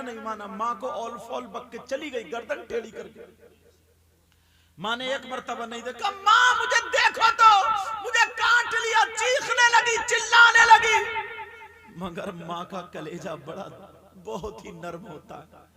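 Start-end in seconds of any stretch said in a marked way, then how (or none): 6.1-11.2 high, loud, slow chanted preaching; impassioned sermon chant; preaching at full force. Acted. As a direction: wail it out like a fiery preacher over the crowd.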